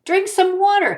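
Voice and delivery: high-pitched storybook ghost; ghost giving advice. Acted. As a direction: pipe up with a remedy. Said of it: "he says".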